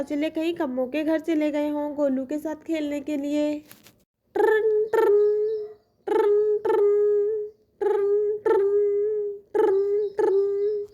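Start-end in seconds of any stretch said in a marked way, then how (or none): 4.3-10.9 phone ring. Acted. Mother calling Kammo, the phone rings, voiced. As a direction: imitate a phone ringing.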